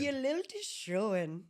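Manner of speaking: put-on high, loud Irish accent; mock Irish lilt. Host teasing about a politician's lilt, mock Irish. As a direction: sing it out in a hammed-up Irish lilt. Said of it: "I can see it in her lilt".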